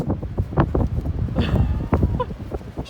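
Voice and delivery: wild, high unvoiced cackle; manic high laugh. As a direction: let out a crazed giddy laugh. Manic laugh after imitation.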